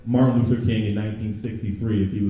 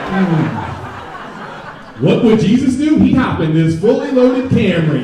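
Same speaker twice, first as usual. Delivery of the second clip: higher, louder hammed-up ad pitch; mock commercial voice; 7 semitones higher, 8 dB louder, slower than usual. Comic spoofing Jesus in a car commercial, loud pitchman. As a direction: sell the car like a cheesy announcer.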